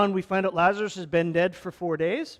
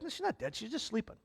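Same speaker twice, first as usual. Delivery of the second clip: Jesus reassuring; 5 semitones higher, 11 dB quieter than usual. Retold or imitated. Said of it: "he's like".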